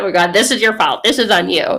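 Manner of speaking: loud, laughing mock accusation; playfully pin the blame on God; mock blaming. Speaker mimics how we blame God, mock indignant.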